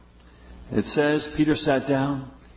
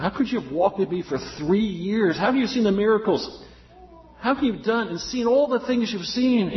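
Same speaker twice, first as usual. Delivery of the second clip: lightly voiced reproachful Lord, climbing higher each question; mock rebuke; 8 semitones higher than usual. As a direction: reproach him gently, pitch rising with every question. Imagined Lord rebuking Peter.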